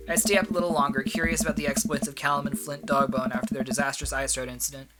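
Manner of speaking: usual speaking voice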